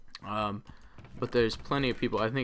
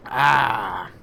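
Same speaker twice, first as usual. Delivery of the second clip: refreshed sigh; 2 semitones higher, 10 dB louder than usual. Real, not acted. After a swig of root beer.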